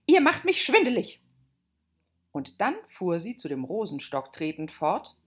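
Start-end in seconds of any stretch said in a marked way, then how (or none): 0.1-1.2 high, loud queenly complaint; screeching. Acted. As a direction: shriek it in irritation. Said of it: "kreischte die Königin".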